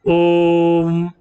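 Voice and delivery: ओम held very long and slow on one breath. Shown as a demonstration. Teacher demonstrating pluta ओम to the class, very slow. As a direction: draw ओम out like a chant, three beats long. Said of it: "हम उसको दीर्घ श्वास में दीर्घ क-, क-, उच्चारण करते हैं".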